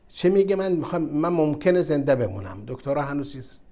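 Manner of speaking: normal talking voice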